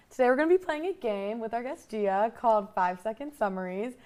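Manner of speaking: usual speaking voice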